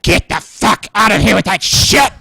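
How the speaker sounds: hammed-up shout of rejection; imitated angry outburst. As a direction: act out a disgusted partner throwing someone out. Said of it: "And Holly's like".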